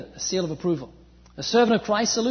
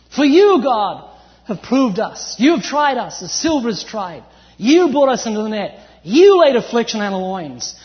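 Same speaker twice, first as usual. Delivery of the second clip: fervent scripture reading; 6 dB louder than usual. Acted. Preacher reading a psalm to the congregation, fervently. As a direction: proclaim it like a preacher.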